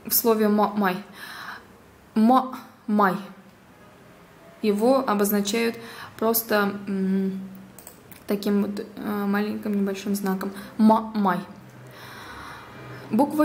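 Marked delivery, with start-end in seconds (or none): 0.5-3.1 «ма-» broken off short, then «май»; teacher's careful split «ма-, май»; very short sound. Shown as a demonstration. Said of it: "короткий звук «э»". 10.8-11.4 «Ма-» cut short before «май»; isolated teaching demo of «Ма-, май»; very short sound. Shown as a demonstration. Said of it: "короткий звук «э»".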